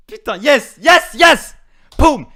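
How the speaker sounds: very loud, high yells; victory cheer. Real, not acted. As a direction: scream yes with joy. Winning again.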